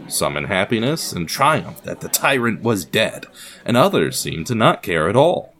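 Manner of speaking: ordinary talk, nothing marked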